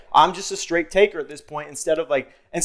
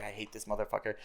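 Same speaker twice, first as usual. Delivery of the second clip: hushed grudge; 7 semitones lower, 19 dB quieter than usual. Retold or imitated. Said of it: "I was like".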